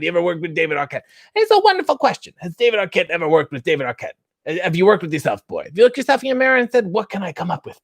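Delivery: fast, fussy talk-show host voice; Jiminy Glick. Acted. Answering chat's request as Jiminy Glick, fast. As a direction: grill the guest like a goofy interviewer. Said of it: "in a Jiminy Glick voice".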